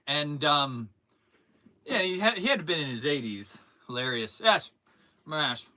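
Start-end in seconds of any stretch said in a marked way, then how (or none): none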